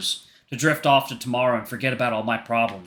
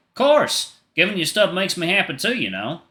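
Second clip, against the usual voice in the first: higher, lilting country voice; louder, animated country twang; country accent; 7 semitones higher, 4 dB louder than usual. Acted. David happy to give her things, country accent. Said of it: "David's getting a country accent".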